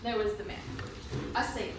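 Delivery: ordinary talk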